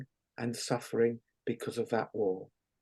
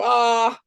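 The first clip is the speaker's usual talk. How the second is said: sharp high "Ah!" laugh, much higher; burst of laughter; 8 semitones higher, 5 dB louder than usual. Shown as a demonstration. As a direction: fling out one big laughing Ah. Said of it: "We're gonna go".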